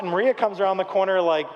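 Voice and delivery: ordinary talk, nothing marked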